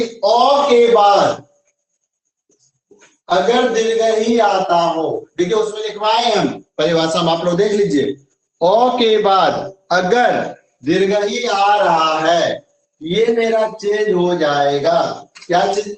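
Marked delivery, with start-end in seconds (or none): 0.0-5.1 each word drawn out for dictation; slow dictation. Shown as a demonstration. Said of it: "teacher dictating slowly". 8.6-12.6 slow, word by word for notes; slow dictation. Shown as a demonstration. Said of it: "teacher dictating definition slowly".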